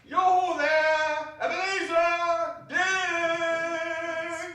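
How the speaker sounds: jovial call. Acted. Fezziwig calling his apprentices, jovial sung call. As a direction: hail them cheerfully across the room. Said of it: "comfortable, rich, fat, jovial voice".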